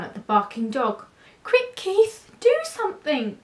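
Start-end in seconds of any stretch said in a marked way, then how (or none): 1.4-3.4 high, alarmed plea; urgent cry. A narrator's reading. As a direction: beg him to act at once. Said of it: "they cried".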